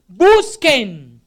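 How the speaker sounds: loud, forceful command; shouted imperative. Retold or imitated. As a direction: shout the word as an order. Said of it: "son tres gritos del Señor Jesús".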